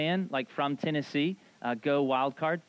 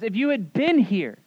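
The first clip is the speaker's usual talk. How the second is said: imitated reproach, BEEN raised and loud; reproachful stress on BEEN; 5 semitones higher, 6 dB louder than usual. Mary meets Jesus after her brother's death, reproachful stress on "been". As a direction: lean loudly on "been" in retold complaint. Retelling Mary's same complaint to Jesus.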